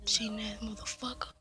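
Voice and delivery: ordinary talk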